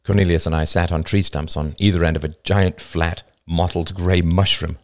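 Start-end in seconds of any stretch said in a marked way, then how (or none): none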